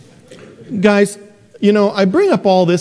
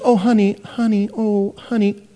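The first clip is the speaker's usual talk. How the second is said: higher, pleading honey honey; mock pleading mother; 6 semitones higher than usual. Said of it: "And she says".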